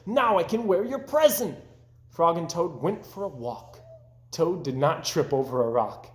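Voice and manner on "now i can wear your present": high, loud and thrilled; delighted. A narrator's reading. Toad telling Frog his hat fits, delighted. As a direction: burst with joy at the news.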